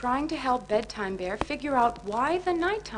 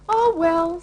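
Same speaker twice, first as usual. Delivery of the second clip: higher, bright and bouncy; cheerful; 7 semitones higher, 7 dB louder than usual. Acted. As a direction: shrug it off happily. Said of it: "said Funshine Bear cheerfully".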